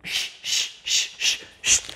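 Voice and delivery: long repeated "shh" blown out; voiceless hiss of shushing; shushing sound. Shown as a demonstration. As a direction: shush rhythmically for a child to copy.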